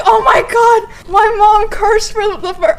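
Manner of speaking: high, loud, gasping disbelief, slowed on oh my God; shocked excitement. Retold or imitated. Hearing mom curse, shrieking in gleeful disbelief. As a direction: squeal it in delighted shock.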